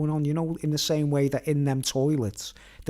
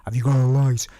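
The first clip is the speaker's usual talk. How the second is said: stranger's voice; 7 dB louder than usual. Retold or imitated. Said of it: "I just heard his voice".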